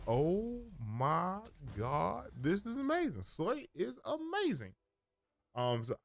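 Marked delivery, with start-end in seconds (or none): 0.1-3.2 high, slow awestruck delight; amazed excitement. Retold or imitated. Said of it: "That moment of clarity when you're like". 3.2-4.7 high, slow delighted gush; excited. Real, not acted. Thrilled by game mechanic.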